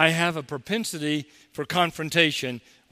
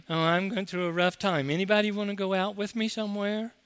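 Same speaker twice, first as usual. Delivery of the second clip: higher, quick put-on whine; mock a moping kid, hurried at the end; whining student; 5 semitones higher than usual. Teacher imitating a whining student at school, higher whine. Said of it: "they whine".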